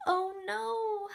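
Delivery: loud, high dismayed cry; alarmed. Acted. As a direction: gasp it out like bad news. Story alarm, reading to kids.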